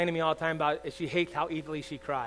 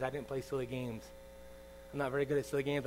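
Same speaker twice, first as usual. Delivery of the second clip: ordinary talk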